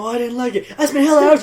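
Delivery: mock offended cook.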